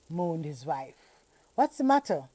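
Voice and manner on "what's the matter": high, loud worried question; husband alarmed at his wife's face, anxious. Acted. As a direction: ask anxiously, fearing bad news. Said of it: "said Mister Pinner anxiously".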